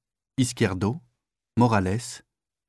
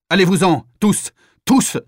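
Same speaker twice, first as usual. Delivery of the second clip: higher, louder command to clear the room; sharp shouted order; 6 semitones higher, 4 dB louder than usual. Acted. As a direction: drive them all out. Officer orders everyone out.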